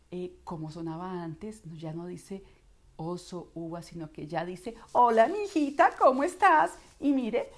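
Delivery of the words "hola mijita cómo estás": much higher and louder, squeezed up in the throat; voz con laringe alta. Shown as a demonstration. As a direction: push the larynx way up and chirp it out. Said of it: "la voz, la laringe se fue muy arriba". (voz aguda con la laringe arriba)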